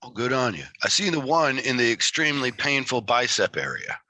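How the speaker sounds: ordinary talk, nothing marked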